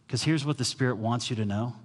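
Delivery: normal talking voice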